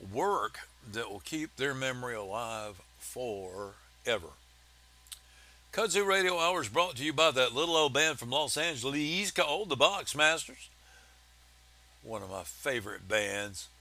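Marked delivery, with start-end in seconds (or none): 5.7-10.7 ad read. Acted. Sponsor read.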